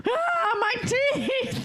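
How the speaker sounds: pained high cry; sensitive teeth wincing. Acted. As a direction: wail about aching teeth. Improv scene, toothache cry.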